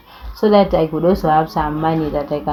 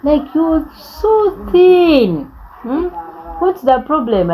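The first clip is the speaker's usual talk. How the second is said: crying mother; 8 semitones higher than usual. Retold or imitated. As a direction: wail it like a mother in tears. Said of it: "she cried".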